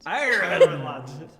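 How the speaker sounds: put-on loud, drawn-out farmer's-accent "Ireland". Mocking a friend's pronunciation, exaggerated farmer's accent. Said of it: "in a farmer's voice".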